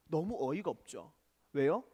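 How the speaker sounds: usual speaking voice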